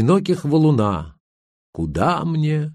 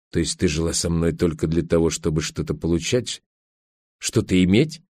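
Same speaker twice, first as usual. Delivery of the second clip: low, softer voice of a baffled husband; dull, dazed question; 5 semitones lower, 3 dB quieter than usual. A narrator's reading. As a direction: ask it dully, without force. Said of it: "тупо спросил Олег".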